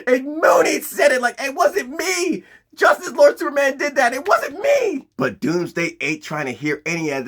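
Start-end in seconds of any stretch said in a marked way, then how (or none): 0.1-5.1 high-pitched mock desperate shouting; Dave Chappelle shouting out. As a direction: cry out in high, panicked protest. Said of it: "just shouting out".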